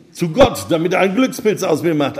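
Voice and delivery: high, loud, fast reply; eager young man. A narrator's reading. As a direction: blurt it out loudly and quickly. Eager young man answers the woman.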